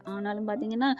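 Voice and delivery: ordinary delivery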